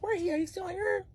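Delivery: high, puzzled questions; startled and confused; confused surprise. Retold or imitated. Waking up, partner missing.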